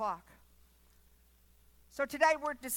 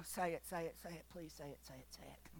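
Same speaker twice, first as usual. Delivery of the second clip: softer and lower, whispered begging; pleading whisper; 8 semitones lower, 15 dB quieter, faster than usual. Pretend. Believer at heaven's gate begging God to speak, pleading whisper. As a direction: breathlessly plead under your breath.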